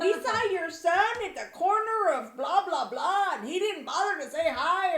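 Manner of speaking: high, loud mock busybody mother; gossiping mom. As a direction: ham up a tattling neighbour mom. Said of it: "your mom would have known about it and be like".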